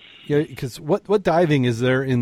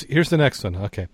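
ordinary talk, nothing marked

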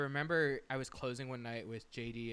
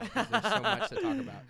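fast, louder, laughing through the words; chuckling while promising more stories; 9 semitones higher, 10 dB louder than usual. Real, not acted. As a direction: giggle through the gossip. Laughing while teasing about Kyle.

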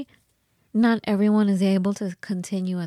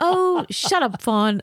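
put-on high, drawn-out French-accented teasing; Martine; 6 semitones higher, 4 dB louder, slower than usual. Friend brushing off her worries, high mock French accent. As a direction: playfully scold in an imitated French accent, pitched way up. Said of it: "with her French accent".

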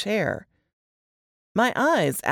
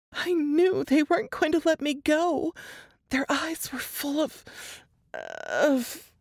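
higher, strained voice of a frightened woman; Laura reliving the horror, voice pitched up; shaken young woman; 11 semitones higher than usual. A narrator's reading. Laura telling Jess and Nate her ordeal, higher and shaken.

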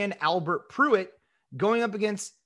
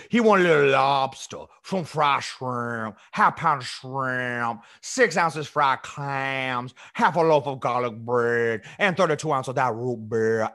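a lower, slowed Cajun bayou drawl, a bit overdone; Cajun bayou accent; 6 semitones lower than usual. Pretend. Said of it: "you really gotta work on your bayou accent".

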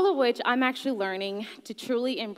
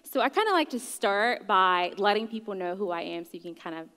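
ordinary talk, nothing marked

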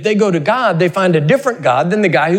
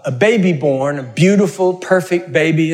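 usual speaking voice

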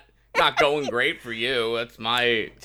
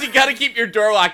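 high, loud imitation of a warning; mock scolding yell; 9 semitones higher, 6 dB louder than usual. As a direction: call it out like a hammed-up telling-off. Acting out character's scolding warning.

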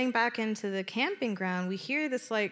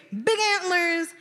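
ordinary delivery

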